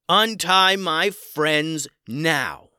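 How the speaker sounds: slow, loud, forceful command; commanding shout. A narrator's reading. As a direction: order it with full force. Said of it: "said Max".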